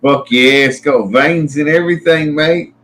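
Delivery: mock Aussie bloke voice; Aussie accent. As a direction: play a cheeky Aussie mate. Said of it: "that's how it sounds too".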